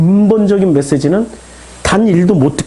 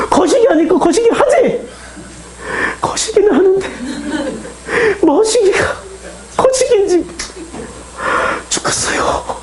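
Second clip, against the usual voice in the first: very high choked voice fading to unvoiced; high, halting, tearful Jeolla dialogue; acted tearful anguish; 10 semitones higher than usual. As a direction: act out Peter breaking down. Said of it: "이건 지금 눈물 나는 장면이라고요".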